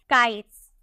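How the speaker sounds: "काइट्स" ending in an unvoiced S. Shown as a demonstration. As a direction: say "काइट्स" with T then a hissed S. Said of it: "उसका pronunciation आपको सौ में करना है".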